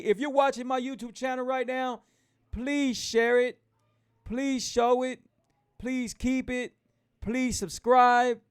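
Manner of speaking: high, drawn-out pleas to the audience; insistent host rallying subscribers; emphatic appeal. Real, not acted. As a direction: punch each request slowly and high. Urging viewers to share and subscribe.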